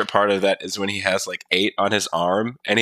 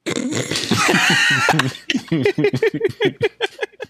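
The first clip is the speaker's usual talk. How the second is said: high laugh; 11 semitones higher than usual. Real, not acted. Laughs at intro.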